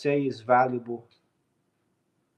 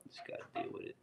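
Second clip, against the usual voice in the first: a breathy, resigned whisper; 19 dB quieter, much faster than usual. Real, not acted. Shrugging off the phone glare, whispered to himself. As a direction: let it go in a whisper.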